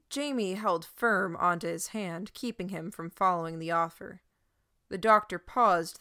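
plain reading voice

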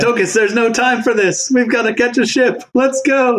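high-pitched, impatient rallying; urgent hurrying. Acted. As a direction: rush a dawdling companion along, pitch climbing. Hurrying companion to the ship.

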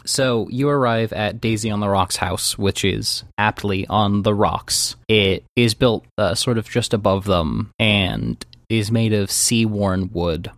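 low, even scene-setting voice; game master narration. Acted. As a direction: set an eerie scene, low and measured.

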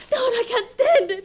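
begging him in panic not to open it; frightened sob. Acted. Wife pleading with Gerald over the letter, sobbing in fear.

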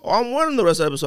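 high, fast and excited; quick high excited promise; eager. Real, not acted. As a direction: blurt it out, thrilled. Eager reply about wearing sunglasses.

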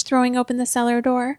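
plain reading voice

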